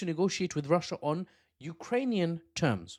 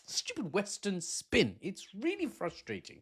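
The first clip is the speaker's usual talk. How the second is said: exasperated, pitched-up complaint, slowing on the words; frustrated outburst; 6 semitones higher, 4 dB quieter than usual. Real, not acted. Host fed up with Western news spin, frustrated.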